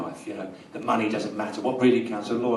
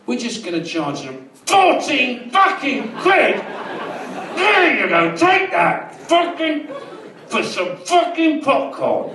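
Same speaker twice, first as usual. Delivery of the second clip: high, loud, slow shouting rant; raging shout over the price of food; furious rant; 7 dB louder, much slower than usual. Retold or imitated. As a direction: roar it at the cinema bosses. Ranting at cinema bosses.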